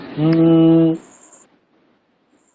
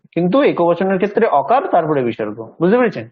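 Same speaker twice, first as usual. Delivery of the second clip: ordinary talk, nothing marked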